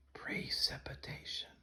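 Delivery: breathy whispered word. Shown as a demonstration. Teacher leading a whispered class repetition. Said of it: "Let's do this one as a whisper".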